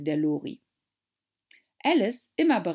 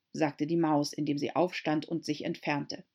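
ordinary delivery, nothing marked

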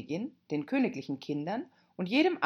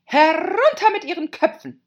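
high, very loud execution order; furious screaming; 13 semitones higher, 13 dB louder than usual. Acted. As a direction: scream it as a raging queen. Queen orders the gardeners' execution.

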